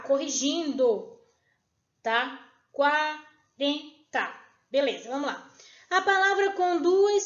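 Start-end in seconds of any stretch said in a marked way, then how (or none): 2.7-4.3 "Quarenta" very slowly, split qua-ren-ta; syllable by syllable. Shown as a demonstration. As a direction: break "Quarenta" into three separate syllables. Corrects the word syllable by syllable.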